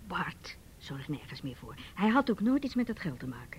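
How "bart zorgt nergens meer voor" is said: slow grim whisper; hushed bitter whisper. Acted. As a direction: whisper it slowly and heavily. Secret family money talk.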